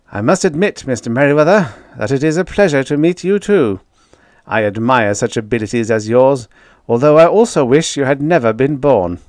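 grudging respect, lightly voiced; president's voice. President to the prisoner, grudgingly formal.

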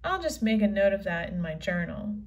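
ordinary talk, nothing marked

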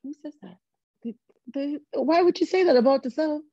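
can't believe what you just heard; rising pitch of amazement; baffled disbelief. Retold or imitated. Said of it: "And I was just like".

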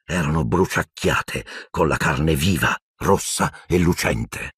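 ordinary delivery

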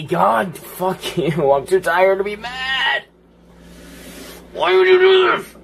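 high-pitched wail of gamer frustration; exasperated yelling. Real, not acted. Player too tired to be mad at the game, high exasperated yelling. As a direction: wail it loudly, climbing to a squeal. Raging at game that beat him.

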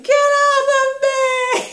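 very high, loud, drawn-out yell; shrieking child impression, every word stretched; imitated child's tantrum. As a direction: scream it slowly like a furious toddler. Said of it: "but I tried another time".

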